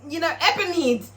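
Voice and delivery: high-pitched anxious squeal; comically high, nervous retelling; worried for her. Real, not acted. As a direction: voice shooting way up in worry. Admits fear for Whisper, pitch jumps.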